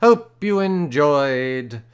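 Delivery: drawn-out announcer outro; slow grand sign-off. Acted. Reader closing the Frankenstein episode, slow and grand. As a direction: stretch every word like a host. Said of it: "slow mock-grand outro".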